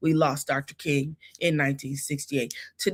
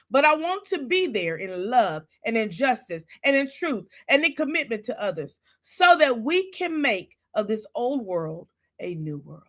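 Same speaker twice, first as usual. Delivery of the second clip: raised, preacher-style recitation of a King sermon; preaching; 7 semitones higher than usual. Acted. Livestream host reciting a Martin Luther King sermon, preaching. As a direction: preach it like Doctor King from the pulpit.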